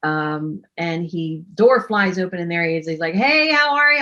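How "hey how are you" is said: high, loud retold welcome; excited greeting. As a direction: imitate an eager host calling hello.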